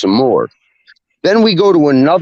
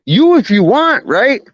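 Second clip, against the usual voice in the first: mock-defiant answer to the bigger kid; cocky comeback; 11 semitones higher than usual. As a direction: toss it back daring him. Firing back at the rival.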